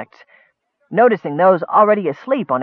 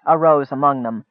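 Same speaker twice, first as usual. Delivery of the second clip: plain reading voice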